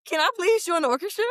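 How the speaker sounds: high-pitched imitation of a child's plea; begging. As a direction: plead with your parents, pitched well up. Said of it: "I begged my parents".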